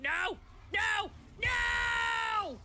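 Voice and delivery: panicked cries climbing in pitch; real panic in the game, rising shouts. Real, not acted.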